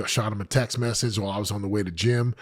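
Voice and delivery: ordinary talk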